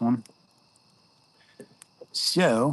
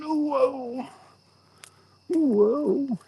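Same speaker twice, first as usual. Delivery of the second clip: high, startled whoa; surprised whoa. Real, not acted. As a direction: react to the view flipping. Camera flip reaction.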